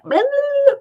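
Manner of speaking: high, loud wordless babble; imitated garbled yelling. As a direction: mimic a stream of bleeped-out shouting. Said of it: "It was just like".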